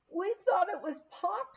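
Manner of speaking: put-on high, teary child's voice; tearful little girls. A narrator's reading. Little girls confessing to their parents, lightly teary and high. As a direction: sniffle it out like a small crying girl. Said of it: "said our girls in tears".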